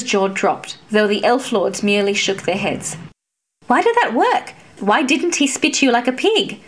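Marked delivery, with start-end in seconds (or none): 3.7-6.6 much higher, astonished questions; amazed squire rushing to her side; demanding disbelief. A narrator's reading. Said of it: "Alex demanded".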